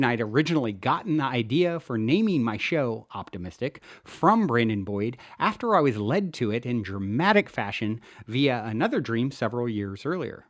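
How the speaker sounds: ordinary delivery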